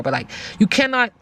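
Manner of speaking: ordinary talk, nothing marked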